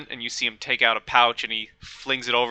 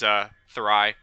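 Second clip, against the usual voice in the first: normal talking voice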